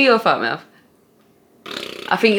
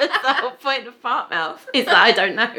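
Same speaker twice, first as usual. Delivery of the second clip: high, amused giggle; laughing as you explain; 8 semitones higher than usual. Real, not acted. Laughing at game.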